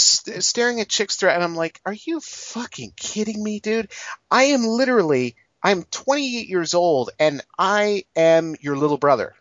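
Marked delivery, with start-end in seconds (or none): 1.8-9.3 exasperated rant. Retold or imitated. Exasperated rant at on-screen character.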